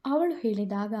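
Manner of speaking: ordinary delivery, nothing marked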